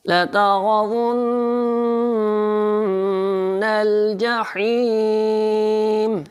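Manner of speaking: very slow melodic recitation, nasal hum on the doubled nun; slow Quran chant with nasal ghunna. Shown as a demonstration. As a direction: draw out لترون with a humming nasal. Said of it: "یہاں پہ تھوڑا سا کھینچ کر پڑھیں گے".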